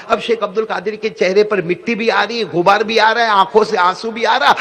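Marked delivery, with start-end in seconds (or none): none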